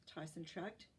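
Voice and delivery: usual speaking voice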